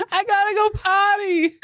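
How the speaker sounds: high, mock-desperate whine; mom's pleading excuse. Daughter mimicking mom making excuses to avoid tickles, high plea. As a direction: imitate a mom begging to escape. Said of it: "make any excuse she can".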